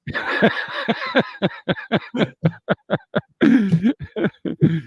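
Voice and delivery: a long, loud burst of real laughter. Real, not acted. Host laughs at his co-host's five-by-five, long real laughter. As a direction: crack up at the radio jargon.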